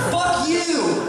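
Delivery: high, yelled insult; shouted comeback. Real, not acted. Comic to an unknown heckler in the audience, high shout. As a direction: shout it out at the crowd. Yells back at audience member.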